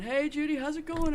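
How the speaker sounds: lightly acted neighborly hello; imitating neighbor's greeting. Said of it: "and he's going".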